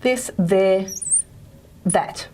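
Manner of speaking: "this, there, that" as separate example words with voiced TH. Shown as a demonstration. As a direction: demonstrate the buzzing TH in "this, there, that". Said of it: "Um, the, this sound".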